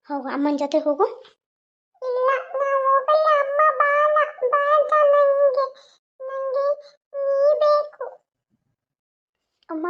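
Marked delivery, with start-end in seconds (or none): 2.0-8.0 put-on high, pleading little-girl voice; whining child. Little girl begs the woman to stay, high and pleading. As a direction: plead like a clinging toddler.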